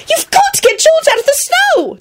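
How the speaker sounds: very high-pitched urgent shriek. A narrator's reading. Ginny begs Lupin to save George, shrieking. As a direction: shriek the demand. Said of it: "Ginny shrieked".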